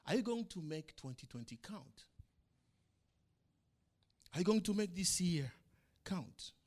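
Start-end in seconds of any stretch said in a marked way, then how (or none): none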